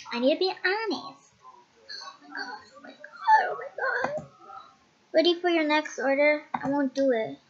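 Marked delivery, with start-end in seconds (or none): 2.2-4.3 much higher, far-off panic; high panicked repeat. Acted. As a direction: squeal in alarm.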